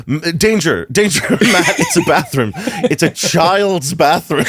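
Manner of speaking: hammed-up panic with laughter creeping in; mock alarm. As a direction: sound a comic alarm to yourself. Voices his brain's sudden alarm.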